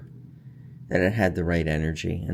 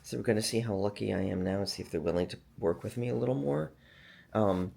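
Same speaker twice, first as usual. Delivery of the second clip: usual speaking voice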